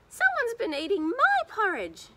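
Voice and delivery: put-on high wailing baby bear voice; Baby Bear crying. A narrator's reading. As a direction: squeak it out like a tiny upset bear. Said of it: "cried Baby Bear".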